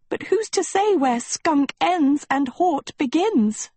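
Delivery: put-on high-pitched, put-upon girl with a shudder of disgust; melodramatic Sophie, aggrieved. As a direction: complain dramatically, drawing out key words. Narrator plays suffering Sophie.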